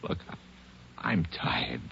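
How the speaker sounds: faint, breathy and worn out; exhausted whisper. Acted. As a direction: fade out from fatigue. Suspect exhausted.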